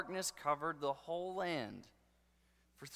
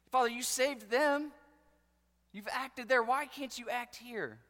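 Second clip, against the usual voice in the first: raised, higher voice crying out to God; pleading prayer; 6 semitones higher, 5 dB louder than usual. Retold or imitated. Preacher voicing his own struggling prayer, raised and pleading. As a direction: press God for an answer, voice lifted high. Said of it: "and I've said".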